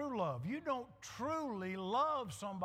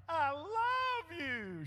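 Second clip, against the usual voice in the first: slow, higher, stretched declaration; drawn-out mock tender; 6 semitones higher, 4 dB louder, much slower than usual. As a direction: drag it out like a crooning husband. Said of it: "I said".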